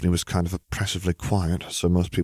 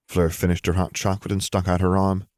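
plain reading voice

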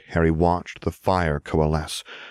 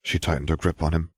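usual speaking voice

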